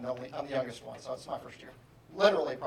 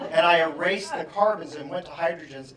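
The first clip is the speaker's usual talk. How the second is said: ordinary talk, nothing marked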